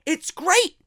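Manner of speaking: great lifted high with a rising tune; praising wrestlers who cut their own promos, excited; enthusiastic. Real, not acted. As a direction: sell it with bright enthusiasm.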